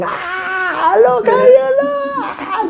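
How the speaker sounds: very high squealing mock laughter; imitating laughing kids. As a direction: cackle the words like kids laughing at dad. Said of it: "and they're laughing".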